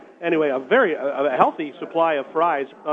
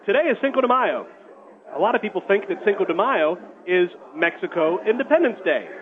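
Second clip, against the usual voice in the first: radio announcer; 4 semitones higher than usual. Acted. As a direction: pitch the contest to listeners. Radio contest call to action.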